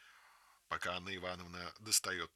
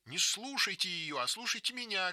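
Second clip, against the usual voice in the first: put-on childish shout; 10 semitones higher than usual. A narrator's reading. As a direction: shout down the other girl. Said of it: "кричит Маруся".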